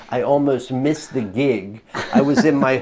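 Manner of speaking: normal talking voice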